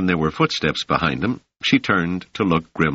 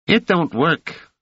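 put-on high, cocky street kid; brash young urchin, pitched up; raised boy's voice, offhand; street boy Rache; 12 semitones higher, slower than usual. A narrator's reading. Rache speaks.

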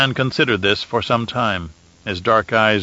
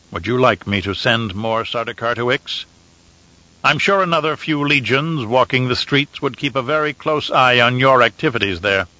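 usual speaking voice

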